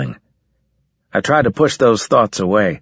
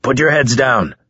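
mild shouted warning, pitched up; narrator's contained shout, raised in pitch; shouted order; 4 semitones higher than usual. A narrator's reading. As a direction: call it out as a command. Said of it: "he shouted".

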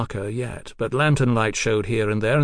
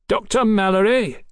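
calling out; 10 semitones higher, 4 dB louder than usual. Acted. Besieger calls up to the doctor from the siege works, calling out. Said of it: "A warped and muffled voice".

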